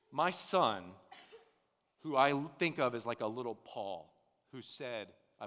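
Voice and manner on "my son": normal talking voice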